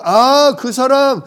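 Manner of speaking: high-pitched "oh, that one!" recognition; instant recognition. Acted. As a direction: act out suddenly placing a familiar name. Acts out recognising a familiar name.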